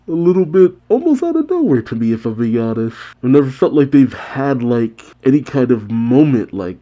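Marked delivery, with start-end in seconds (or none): 0.1-1.8 incredulous. Real, not acted. Puzzled reaction, pitched up.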